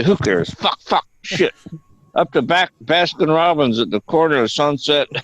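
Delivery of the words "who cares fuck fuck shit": tic swears blurted a little higher; imitating Tourette tics. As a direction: spit out the tics as the guy did. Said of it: "he goes".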